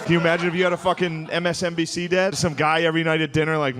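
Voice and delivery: normal talking voice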